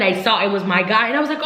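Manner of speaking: ordinary talk, nothing marked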